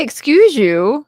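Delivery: mock indignation. Mock offence at geography mistake.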